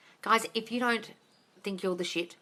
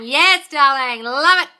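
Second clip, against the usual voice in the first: slow, high, loud gushing yes; gushing approval; 6 semitones higher, 12 dB louder, much slower than usual. Real, not acted. Praising a viewer's affirmations, loud gushing delight. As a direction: gush over it like a proud mentor.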